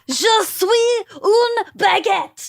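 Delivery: put-on slow, high, drunk Brit mangling French; drunk British tourist. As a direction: slur it like a wasted English fan. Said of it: "he just goes".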